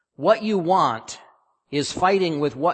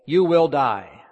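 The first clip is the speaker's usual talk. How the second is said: "you will die" louder, each word weighted; absolute certainty; 6 dB louder, slower than usual. Acted. God's prohibition on the fruit, stated with absolute certainty. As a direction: hammer "you will die" with a final fall. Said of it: "Absolute certainty".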